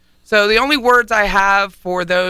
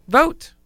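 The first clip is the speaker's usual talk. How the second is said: "Vote." pitched high and rising; emphatic rising word; 7 semitones higher than usual. Real, not acted. Host giving a final plea to vote. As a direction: end on a bright, urging "Vote.". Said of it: "I have one word".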